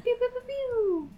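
high zappy laser pews; laser pew sounds. Pretend. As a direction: fire off little laser blasts.

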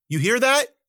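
high, loud alarmed call. Acted. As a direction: call across the ditch urgently. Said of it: "Wildcat called".

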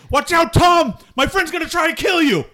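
high, loud warning shout; yelled warning. Acted. Player warning Tom about an attacking friend, yelling. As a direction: yell it out to warn him. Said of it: "I'm gonna yell".

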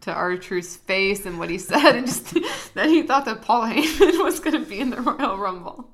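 real giggles breaking through the words; laughing while talking. Real, not acted. Co-host recalling R-Truth's blunder, laughing through it. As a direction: keep talking as laughter takes over, voice rising. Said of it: "still laughing".

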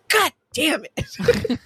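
slow, mock-grumpy little kid curse; toddler cursing. Nephew failing to cock the laser gun, slow toddler grumble. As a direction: grumble it slowly like a frustrated toddler. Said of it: "And he goes".

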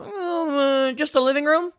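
mock Republican offering a tiny compromise; timid Republican. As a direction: ask sheepishly, as a caricature. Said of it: "And then the Republicans go".

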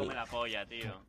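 cursing under breath. Real, not acted. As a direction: mutter the insult under your breath. Muttered insult aside.

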